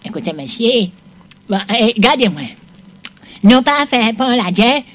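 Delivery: ordinary talk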